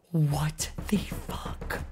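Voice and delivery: breathless whispered disbelief; stunned whisper. Real, not acted. Stunned by the reveal, slow whisper. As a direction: whisper it, too stunned to speak.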